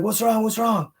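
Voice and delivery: high, anxious repeated question; worried partner. Retold or imitated. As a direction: imitate her startled worry, pitched high. Partner woken by scream, asking.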